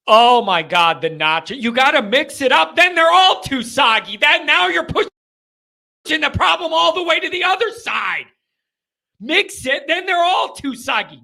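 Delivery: loud, high exasperated rant; heated rant. Real, not acted. Host ranting to listeners about soggy nachos, loud and high. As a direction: rant like it truly matters.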